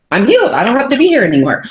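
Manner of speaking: high-pitched, quick mock declaration; breezy imagined announcement. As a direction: toss it off high, then hurry on. Voicing what others would say.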